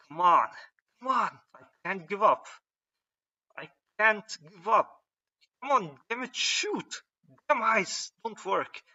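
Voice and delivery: high, loud, pushing against exhaustion; desperate strained cries, pitch soaring; straining desperate push. Acted. Hero straining mid-fight.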